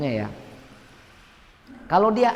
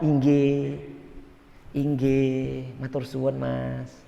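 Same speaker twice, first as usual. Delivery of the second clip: humble polite assent; 5 semitones lower, slower than usual. Retold or imitated. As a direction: agree meekly to end the talk. Humble agreeing reply quoted.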